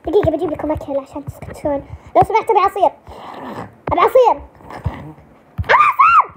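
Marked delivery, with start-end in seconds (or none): 5.6-6.3 shouted demand. Acted. Child demanding juice loudly.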